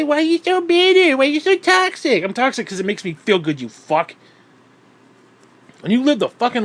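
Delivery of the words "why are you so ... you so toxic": much higher, louder mocking whine; mocking critics. Ranter mocking people who call him bitter, high loud whine. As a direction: throw their question back in a sneer. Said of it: "People say".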